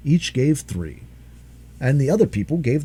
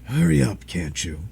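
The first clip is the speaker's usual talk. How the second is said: lower, grumbling dragon voice; grumpy dragon; 3 semitones lower than usual. Acted. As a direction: grumble impatiently. Dragon impatient.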